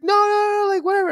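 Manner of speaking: very high, insistent mock protest; imitated protest. Shopkeeper protesting, voiced.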